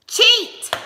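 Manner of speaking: loud, high exclamation of cheat; shouted cheat. Retold or imitated. Mom telling son to cheat at Uno, shouting. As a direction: yell it at the kid.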